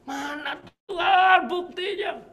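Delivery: loud, high challenge to God; pained shout; demanding cry. Retold or imitated. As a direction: call out for proof.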